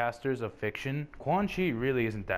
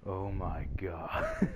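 mock Sans dismay; 3 semitones lower, much slower than usual. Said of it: "Sans would be like".

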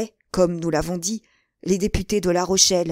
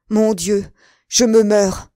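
loud, anguished lament; dying exclamation; 7 dB louder, slower than usual. A narrator's reading. The duke, about to faint, cries out in anguish. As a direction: cry out as life fails.

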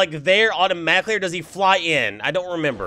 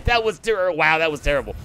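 ordinary talk

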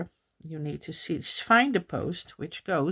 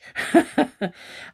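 amused laugh; 7 semitones higher, 4 dB louder than usual. Real, not acted. Laughs at coincidence.

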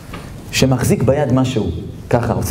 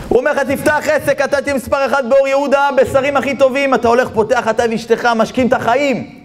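high, driving sales promise; preaching at full force; 10 semitones higher than usual. Acted. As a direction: pitch the dream high and without let-up. Preaches the tempter's grand promise. (דרשה סוחפת בקול גבוה)

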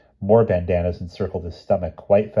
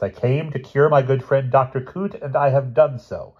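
boastful; 5 semitones higher, 4 dB louder than usual. Acted. Professor boasting to nurses.

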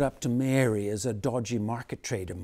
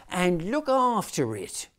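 put-on much higher, louder child voices; children's nativity voices; 7 semitones higher, 5 dB louder than usual. A narrator's reading. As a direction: play the squabbling Mary and angel. Mary continues.

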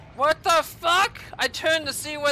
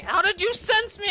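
high, indignant question; pitched-up incredulous complaint; indignant disbelief; 7 semitones higher than usual. Real, not acted. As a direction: ask it outraged, voice shooting up. Repeat, outraged at enemy.

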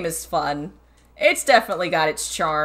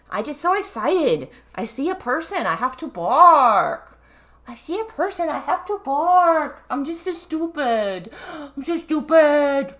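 dog's apology voice; 7 semitones higher than usual. Pretend. Owner voices dog saying sorry for barking, silly dog voice. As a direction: speak for a silly, over-excited pup. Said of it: "You say sorry. Say".

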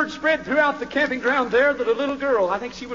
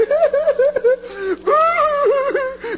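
laugh; 11 semitones higher than usual. Real, not acted. Mocking soap opera viewers.

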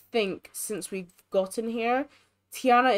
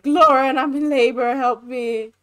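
mock loud plea; 2 semitones higher, 7 dB louder than usual. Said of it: "Complain about problems, like".

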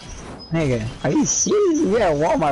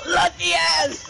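1 envious squeal; 13 semitones higher, much slower than usual. Real, not acted. Envies Foot Locker discounts.

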